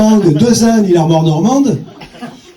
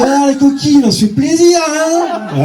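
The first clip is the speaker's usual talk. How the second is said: high, leering tease; mock seductive call to the two women; leering come-on; 8 semitones higher, slower than usual. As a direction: ham up a lecherous come-on. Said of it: "je fais".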